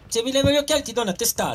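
high-pitched, rapid mock-Sardinian threat; mock Sardinian accent. Joking headbutt threat among teammates, mock Sardinian accent. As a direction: rattle it off in a put-on Sardinian accent.

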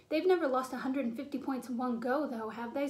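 ordinary delivery, nothing marked

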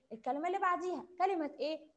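normal talking voice

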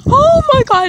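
high, startled "oh my God" at the cold; cold shock gasp. Real, not acted. Vlogger stepping out into the freezing cold, startled high gasp. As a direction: yelp it as the icy air hits.

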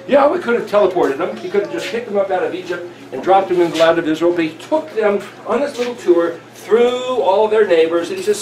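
ordinary talk

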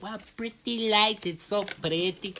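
slow, drawn-out mock wonder; imitating awed onlooker. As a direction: gush slowly, hamming up the awe. Voices awed viewer of Vegas pictures.

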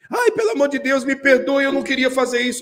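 high desperate pleading; a hint of a sobbing girl begging forgiveness; pleading girlfriend. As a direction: beg him not to leave, voice climbing.

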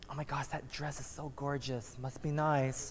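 quiet covetous murmur to yourself; envious inner voice. Retold or imitated. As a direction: sigh it out as a private jealous thought. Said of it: "you don't say it, but you think that".

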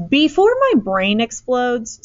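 exasperated. A narrator's reading. Exasperated mother demands explanation.

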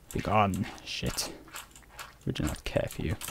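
hushed mock-stern whisper. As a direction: breathe it out under the breath to the game. Dismissing mobs in game.

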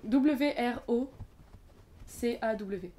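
slow letter-by-letter dictation; spelling out. Shown as a demonstration. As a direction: repeat the spelling slowly, pausing midway. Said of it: "Alors ça s'écrit". (épeler lentement en détachant les lettres)

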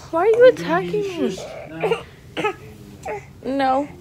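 ordinary delivery

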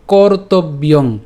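"কর্তব্যং" very slowly, syllable by syllable; pronunciation demo. Shown as a demonstration. Teacher showing what we will pronounce, slow demo. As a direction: stretch "কর্তব্যং" into its separate parts. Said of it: "এখানে আমরা যেটা উচ্চারণ করব".

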